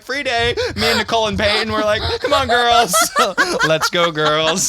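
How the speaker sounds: loud, high rallying call with laughter; excited cheering. Retold or imitated. Twelve-year-olds celebrating a free day, loud excited cheer. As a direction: whoop it up to your friends.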